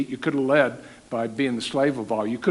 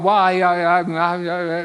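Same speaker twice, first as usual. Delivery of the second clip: loud, higher, stammering excuse; flustered stammer; 3 semitones higher, 4 dB louder than usual. Retold or imitated. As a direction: stumble over the I's, flustered and loud. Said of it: "They don't want".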